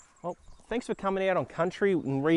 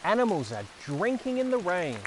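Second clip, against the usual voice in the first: put-on slow, sing-song reading for small children; picture book reading; 4 semitones higher, slower than usual. Reading Thank You Rain aloud to young viewers, gentle storytelling. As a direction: read it out like story time, savouring each word.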